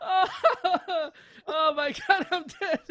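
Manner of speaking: high, loud and breathless with laughter; laughing. Real, not acted. Host recovering from his own joke, laughing. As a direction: still gasping from laughing.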